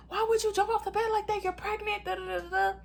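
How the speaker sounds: high-pitched mock telling-off; scolding mom. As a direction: imitate a nagging, scolding mother. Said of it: "my mom started scolding me".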